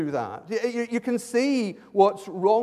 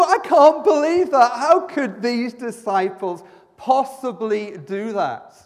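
incredulous; 4 semitones higher, 6 dB louder, slower than usual. Retold or imitated. Man in the street reacts incredulously.

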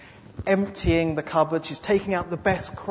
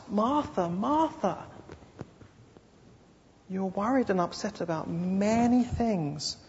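tender rebuke; 3 semitones higher, 6 dB quieter than usual. Acted. Jesus gently correcting Martha.

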